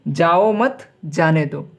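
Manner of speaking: drawn-out "जाओ मत", break, then "जाने दो"; pause after मत. Shown as a demonstration. Teacher reading the second answer, comma heard after "मत". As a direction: stress "मत" and pause before "जाने दो". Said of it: "कहने का अर्थ अलग-अलग है".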